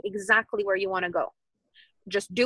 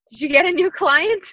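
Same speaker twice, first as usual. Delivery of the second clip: higher, louder, eager re-enactment; excited question; 7 semitones higher, 7 dB louder than usual. Retold or imitated. Wife asking dancing Kevin about a new client, excitedly. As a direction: ask it with rising, bubbling excitement.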